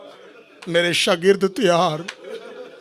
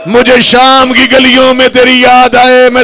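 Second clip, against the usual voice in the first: crying plea; 6 semitones higher, 15 dB louder than usual. Acted. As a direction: cry it out as the sister begging. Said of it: "Alia की चीख निकली". (ऊँची, रोती हुई, धीमी फ़रियाद)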